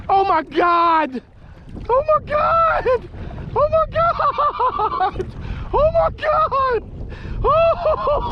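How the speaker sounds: high, shrieking joy at a huge catch; giddy high-pitched whoops; ecstatic yelling. Real, not acted. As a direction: scream it out like you just landed the fish of your life. Sees the huge trout.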